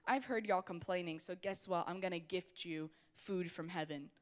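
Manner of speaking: a hint of a big calm God talking down; God's voice. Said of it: "he's like".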